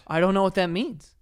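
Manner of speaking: high, loud baffled reply; puzzled pushback at his angry sister; baffled protest. Retold or imitated. Retelling the dream, baffled protest to sister.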